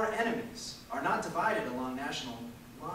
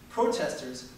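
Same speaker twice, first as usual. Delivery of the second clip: ordinary talk, nothing marked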